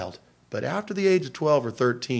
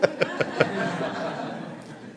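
breathless laugh; 3 semitones higher, 5 dB quieter than usual. Real, not acted. Laughing at story.

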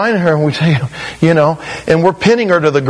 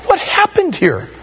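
much higher, squeaked mock amazement; mock astonishment; 14 semitones higher than usual. Preacher marvels at the changed converts, squeaky surprise. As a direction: gasp it out amazed.

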